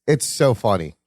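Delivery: ordinary delivery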